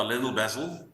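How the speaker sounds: "Lindell Basil" said in an Afrikaans accent. Shown as a demonstration. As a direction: give the name "Lindell Basil" its Afrikaans sound. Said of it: "with my Afrikaans accent".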